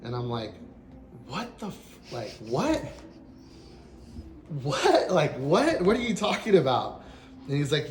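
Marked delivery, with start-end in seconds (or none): 1.3-2.8 high, rising, cut-off question; stunned disbelief. Retold or imitated. Hearing that [name] sings it, stunned. Retold shock at singer's name. 4.6-7.0 loud, high-pitched disbelief; incredulous outburst. Retold or imitated. Answering his chief about [name], incredulous. Retold shock at singer's name.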